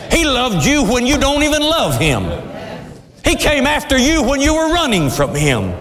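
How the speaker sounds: high, ringing preacher's appeal; preaching at full force. Acted. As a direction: plead with the crowd from the pulpit, pitched high. Preacher raising his voice.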